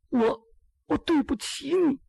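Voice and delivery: a touch of crying as she breaks down; tearful apology. Acted. Girl confessing her fault to Ji Xiaolan, in a rising tearful voice. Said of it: "这眼泪唰下来了".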